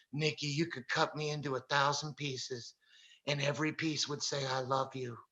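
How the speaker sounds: soft, tender, unhurried; gentle calm reply. Retold or imitated. The preacher answers the gang leader's knife threat softly, tender and calm. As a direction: answer the threat with quiet love.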